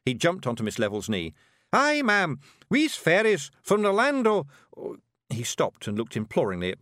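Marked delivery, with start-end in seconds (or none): 1.7-4.5 high, loud Scots announcement; Scottish Feegle, bluffing. A narrator's reading. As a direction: bluff grandly, then get stuck. Voices Rob improvising for Miss Level.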